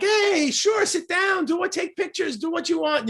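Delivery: friendly staff voice. Retold or imitated. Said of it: "the people are like".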